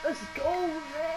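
real excited cheer. Real, not acted. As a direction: cheer the win. Celebrating the win.

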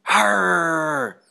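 loud playful growl; mock dog snarl. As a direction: growl at her like a big scary dog. Growling at sleeping girlfriend.

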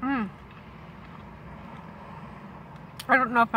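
eating a sandwich; chewing while talking. Real, not acted. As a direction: mouth partly full. Seen 0: Bites sandwich before; jaw working, lips closed through the 'Mm'.

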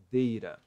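Deira, T of data tapped as R; native R sound. Shown as a demonstration. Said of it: "vai pronunciar com som de". (Deira com som de R, como os nativos)

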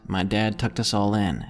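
plain reading voice

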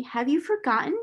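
high, lilting reassurance; cheerful. A narrator's reading. Adopted mother offers herself as mama, cheerfully. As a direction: ask brightly, as to a little child. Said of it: "said Sarah cheerfully".

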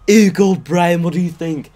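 a hint of Stewie asking his dog. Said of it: "Uh, Stewie".

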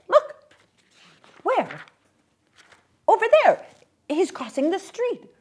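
put-on high, loud eager child's voice; excited child. Young girl showing her mother the man, excited. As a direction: call out and point, thrilled.

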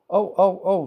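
high-pitched little storybook screams; contained, acted screaming; mock scream. A narrator's reading. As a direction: squeal a string of alarmed ohs. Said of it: "screamed the trucks".

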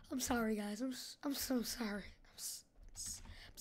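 apologize, shrinking to almost nothing; sorry trailing away into breath; hushed apology. Pretend. Apology fading into a whisper.

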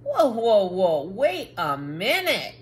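loud, rising whoa to stop everyone; calling for a halt. Acted. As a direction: shout them all down to stop. Book stops the crowd.

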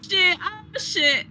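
sudden high panicked yelp; startled shout. Real, not acted. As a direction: yelp as something hits you. Hit by monster.

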